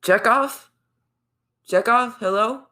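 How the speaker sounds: high, playful search call; high-pitched questioning call. Pretend. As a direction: call into the basement for someone. Calling out for Chekhov in basement.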